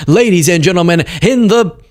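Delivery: high, booming mock fight announcer; boxing ring announcer. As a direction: hype the crowd like a ring announcer.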